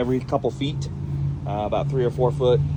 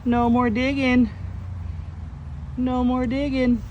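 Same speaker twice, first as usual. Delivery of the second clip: playful scolding; 10 semitones higher, slower than usual. Real, not acted. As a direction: scold a beloved pet in a drawn-out high voice. Playfully scolding the tortoise for digging.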